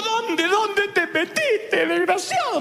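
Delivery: high, slow frantic mom scolding; frantic mother. Retold or imitated. Mother finds her lost son, frantic scolding. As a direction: yell like a panicked mother. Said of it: "la de mi mamá".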